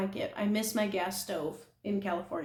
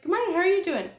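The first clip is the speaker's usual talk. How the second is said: friendly greeting; 10 semitones higher, 5 dB louder, faster than usual. Retold or imitated. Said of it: "he greeted me and he was like".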